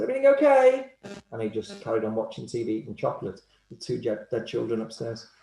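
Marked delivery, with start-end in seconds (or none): 0.0-0.9 high, loud, breezy question; chillingly cheerful as if nothing happened; mock-innocent high chirp to the parents; eerily casual killer. Killer casually greets returning parents.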